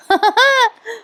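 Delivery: quick high giggle that falls away; real giggle. Real, not acted. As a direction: laugh for real at the joke. Narrator laughs.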